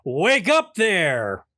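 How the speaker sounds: slow, drawn-out teasing call; mock neighbor taunt. Neighbor jeering at dad in his chair, slow mocking call. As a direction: drag it out like a smug passer-by. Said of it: "he walks by and goes".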